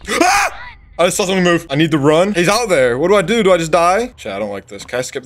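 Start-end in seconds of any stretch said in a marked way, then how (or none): 0.1-0.8 sudden high scream of fright; startled scream. Real, not acted. Jump scare in horror game. 1.0-4.1 higher and louder, frightened rush; panicked. Real, not acted. Panicked after jump scare.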